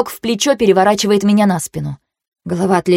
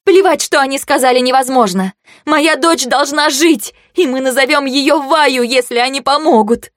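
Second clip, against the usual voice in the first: tearful insistence; 6 semitones higher than usual. Acted. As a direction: insist through held-back tears. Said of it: "звенел от слёз".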